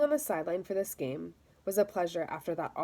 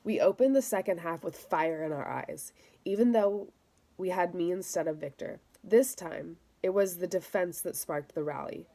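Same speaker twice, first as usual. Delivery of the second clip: plain reading voice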